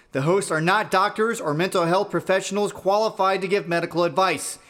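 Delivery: formal announcer read; disclaimer read. Acted. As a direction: read the legal disclaimer crisply.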